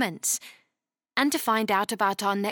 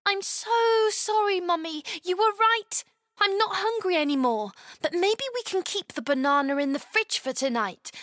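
put-on high, earnest little girl; apologising child, then stumbling; young girl voice; 7 semitones higher than usual. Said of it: "Chloe told her firmly".

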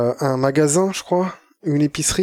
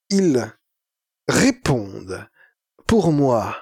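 slow, halting mock philosopher; pompous thinker, pausing between words; 4 semitones lower, much slower than usual. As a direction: weigh each word with long pauses. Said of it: "je fais mon philosophe de Tadei".

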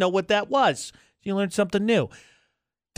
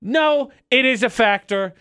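usual speaking voice

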